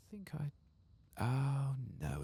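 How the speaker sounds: ordinary talk, nothing marked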